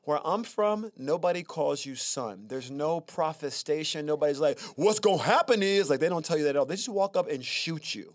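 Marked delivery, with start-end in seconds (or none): 4.8-5.9 mock threat.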